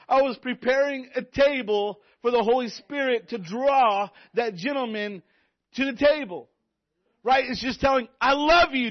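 high, loud preaching that climbs and pounds each line; full-force preaching. Acted. Youth pastor urging the congregation to reach others, loud preaching. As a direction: preach it at the top of your voice, fired up. Preacher drives the point home.

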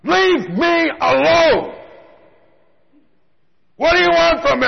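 desperate shout at the heavens; screaming at the sky. Retold or imitated. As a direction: scream it up at God in rage. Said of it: "I'm screaming at the sky".

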